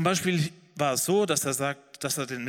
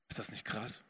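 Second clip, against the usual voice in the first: much softer, lower, an awed aside; amazed aside; 5 semitones lower, 9 dB quieter than usual. Real, not acted. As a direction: drop the voice in wonder. (leise, staunende Zwischenbemerkung)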